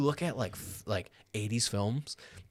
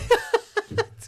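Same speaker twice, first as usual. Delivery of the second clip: high burst of laughter; 8 dB louder than usual. Real, not acted. Laughs at the joke.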